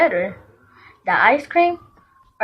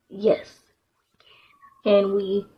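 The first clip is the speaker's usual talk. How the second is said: normal talking voice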